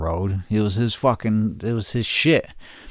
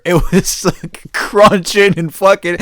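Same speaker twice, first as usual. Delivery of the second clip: ordinary talk, nothing marked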